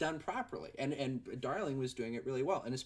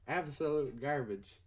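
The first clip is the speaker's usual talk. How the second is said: normal talking voice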